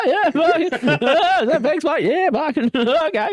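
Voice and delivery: high, laughing, strained polite reply; awkward reaction. Retold or imitated. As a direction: squeak out a cornered thank-you. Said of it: "and you're like".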